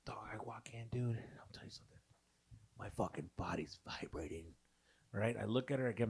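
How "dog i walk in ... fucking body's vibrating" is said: whispered aside to a buddy; confiding whisper. Real, not acted. Man confiding to friends about walking in, hushed whisper. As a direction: drop right down to a whisper.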